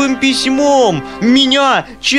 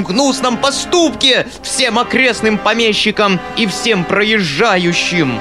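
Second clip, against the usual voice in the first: squealing; 9 semitones higher than usual. A narrator's reading. Foma squeals his threat at the uncle. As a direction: proclaim the scandal to all. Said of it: "визжал Фома".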